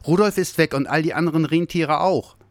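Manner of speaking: little Santa reporting the missing reindeer, high and upset; agitated news. Acted. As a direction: blurt out the bad news. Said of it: "viel zu aufgeregt".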